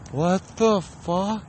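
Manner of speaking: slow, high mock shock; shocked victim. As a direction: draw it out in high-pitched disbelief. Victim hears his name.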